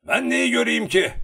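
loud, high, indignant retort; angry. A narrator's reading. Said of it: "dedi öfkeyle".

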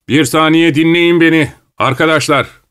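high, loud address to a crowd; calling for attention. A narrator's reading. Kroner asks his friends to listen, calling out. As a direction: make everyone stop and listen.

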